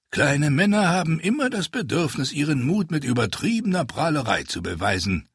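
lightly voiced older ascetic, dry lecture; a hint of a cool, superior explainer; explaining. A narrator's reading. Haldon explains small men's bragging, dryly. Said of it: "erklärte er".

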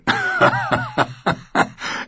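high, wheezy burst of laughter; amused cackle. A narrator's reading. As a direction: laugh out before speaking. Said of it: "Bronn lachte".

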